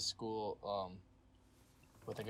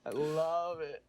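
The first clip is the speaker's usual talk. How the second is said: high, loud, delighted emphasis; gushing delight; 6 semitones higher, 5 dB louder than usual. Real, not acted. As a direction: gush with real relish. Gushing over friend's accent.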